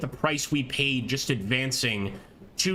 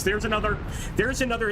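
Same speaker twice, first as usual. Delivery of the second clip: excited; 8 semitones higher, faster than usual. Real, not acted. Sudden barrage appears.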